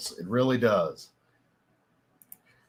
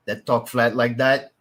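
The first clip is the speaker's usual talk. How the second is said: slow, flat South Louisiana accent; flat Cajun accent. Shown as a demonstration. Showing viewers how locals really talk, flat Cajun accent. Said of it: "that's that accent right there".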